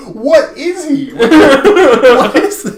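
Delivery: high, slow, laughing disbelief; mock bafflement. Deathstroke asking what Superman is, high, slow and laughing. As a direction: squeak it out in comic shock.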